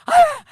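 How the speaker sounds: high, loud frustrated shriek; mock scream. Mother worked up by her daughter, mock scream. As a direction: let out one comic burst of rage. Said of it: "I'm like".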